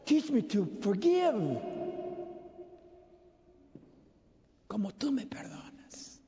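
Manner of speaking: slow prayer trailing into a whisper. Shown as a demonstration. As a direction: murmura la petición, despacio, hasta susurrar. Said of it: "enséñame también a perdonar". (oración lenta que termina en susurro)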